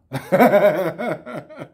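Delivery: real burst of laughter. Real, not acted. Laughing at the woman's outburst, real laugh. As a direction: laugh at the absurd story.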